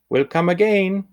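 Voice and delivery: put-on little girls calling a bright farewell from the door; cheery little girls. A narrator's reading. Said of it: "they said".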